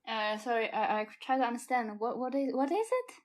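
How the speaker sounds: loud, fast, rising confusion; flustered surprise. Acted. Flustered girl at the party as the stripper walks in, loud and baffled.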